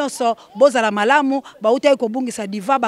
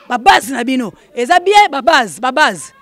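louder, punched repeat; emphatic call-out; 7 dB louder than usual. Real, not acted. Host checking on his supporters, emphatic call-out. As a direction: call it out to the crowd with force.

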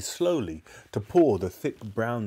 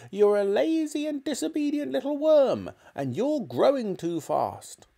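put-on high, nasty old-woman scolding; grandma berating her grandson; spiteful grandma. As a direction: spit the insults like an old hag. Grandma keeps insulting George.

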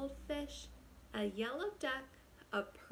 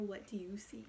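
whispering; 3 semitones lower, 4 dB quieter, faster than usual. Acted. Whispered title repeat.

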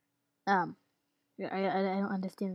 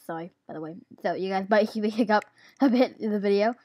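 usual speaking voice

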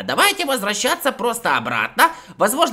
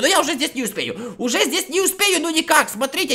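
normal talking voice

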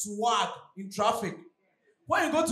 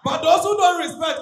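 ordinary talk

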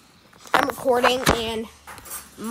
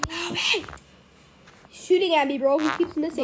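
being chased; 4 semitones higher than usual. Real, not acted. Chased, calling teammates for help.

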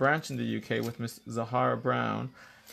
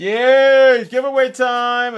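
loud, high, drawn-out celebration easing into announcement; cheer, then announce it brightly; high-energy giveaway hype; excited cheer; 12 semitones higher, 15 dB louder, much slower than usual. Real, not acted.

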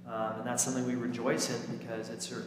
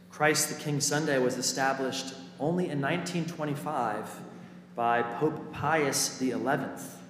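ordinary talk

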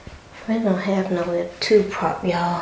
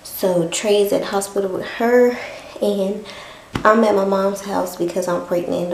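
ordinary delivery

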